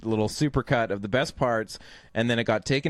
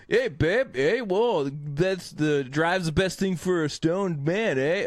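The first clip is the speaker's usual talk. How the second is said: exaggerated Canadian accent, higher and drawn out; 4 semitones higher, slower than usual. Guy at the warehouse party babbling to his babe, mock Canadian accent. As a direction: imitate a stoner with an unreal Canadian accent. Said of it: "Canadian accent".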